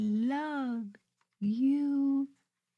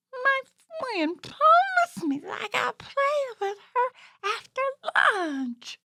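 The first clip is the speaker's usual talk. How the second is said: high, breathy whimpering puppet; mock sad puppet; 13 semitones higher than usual. Puppet telling host about a friend, whimpering. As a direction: whine it like a hurt little kid.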